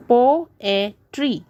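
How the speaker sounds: "Poetry" as po, e, try; three separate syllables. Shown as a demonstration. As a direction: break "Poetry" into three beats. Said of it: "three syllables".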